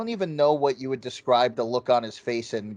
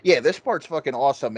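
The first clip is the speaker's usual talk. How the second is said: ordinary delivery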